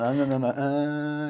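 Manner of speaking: long droning "uh" in mock Cantonese; exaggerated drawn-out imitation; imitated sing-song. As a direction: drag out the vowel, mimicking the sound. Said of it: "It's like a sing-song".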